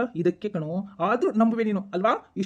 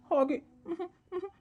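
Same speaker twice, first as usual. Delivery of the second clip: normal talking voice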